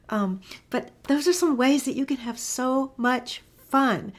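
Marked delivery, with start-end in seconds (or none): none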